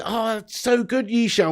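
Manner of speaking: usual speaking voice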